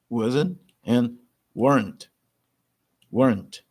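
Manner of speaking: "weren't" as one syllable, "wont", no second e; weren't without the e; contraction demo. Shown as a demonstration. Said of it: "essa segunda letter E você não deve pronunciar".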